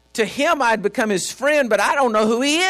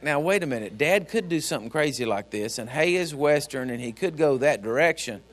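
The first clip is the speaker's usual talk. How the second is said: low, soft musing; thinking to himself; 7 semitones lower, 5 dB quieter than usual. Retold or imitated. Son privately weighing a strange claim about Dad, thinking to himself. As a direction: voice a private thought, much quieter. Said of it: "he might think".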